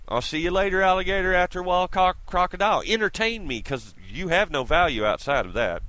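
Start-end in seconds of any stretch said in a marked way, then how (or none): 0.1-2.8 taunting singsong. Real, not acted. Taunting goodbye rhyme.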